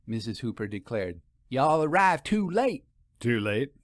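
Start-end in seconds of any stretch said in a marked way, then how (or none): 1.5-2.8 a higher voice for the countrywoman, leaning on late; lightly voiced country woman, raised pitch; a hint of a folksy storekeeper delivering bad news; Missus Hooper. A narrator's reading. 3.2-3.7 puzzled echo. A narrator's reading. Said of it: "Why too late, good woman?".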